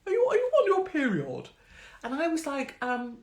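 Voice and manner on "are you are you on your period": mock loud question. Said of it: "that's a bit like saying".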